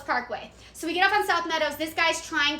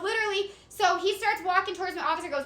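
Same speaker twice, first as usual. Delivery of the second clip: usual speaking voice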